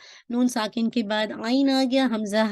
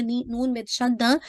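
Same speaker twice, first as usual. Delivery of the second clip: usual speaking voice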